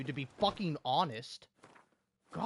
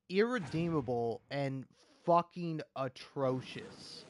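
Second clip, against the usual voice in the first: normal talking voice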